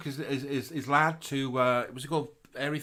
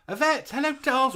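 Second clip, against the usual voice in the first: excited greeting; 12 semitones higher, 6 dB louder than usual. Acted. Greeting a listener in his style.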